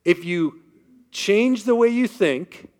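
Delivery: booming God's voice. Retold or imitated. Preacher voicing God to Patrick, booming and slow. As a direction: preach it like the Lord commanding. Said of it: "God says".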